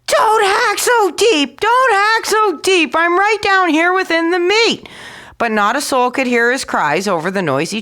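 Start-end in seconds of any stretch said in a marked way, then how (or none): 0.1-4.9 much higher, slow frantic squeal; tiny scream. A narrator's reading. Tom Thumb begging the butcher, high panicked cry. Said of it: "screamed at the top of his lungs".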